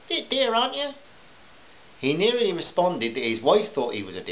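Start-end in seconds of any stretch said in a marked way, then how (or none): 0.1-0.9 high, put-on housewife complaint; high-pitched haggling customer; carping housewife. A narrator's reading. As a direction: query the price in a high customer voice.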